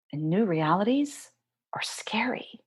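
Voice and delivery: soft unvoiced whisper. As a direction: breathe the words out, barely voiced. Whispered aside about scary newness.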